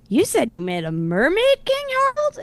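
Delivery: lightly voiced incredulous crowd, pitch leaping at the end; zdumiony tłum. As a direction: question climbing high on the name. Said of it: "and the crowd goes".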